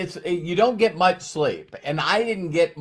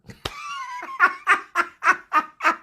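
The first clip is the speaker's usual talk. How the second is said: hearty laugh. Real, not acted. Big laugh after his joke.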